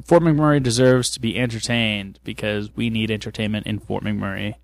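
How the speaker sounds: flat monotone voice. Real, not acted. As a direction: say it in a dull monotone. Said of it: "my voice is so monotone".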